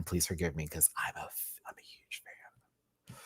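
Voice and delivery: whispered, slowing down on huge fan; emotional whisper. Real, not acted. Confessing to viewers she's a huge fan, whispered. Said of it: "if I start crying".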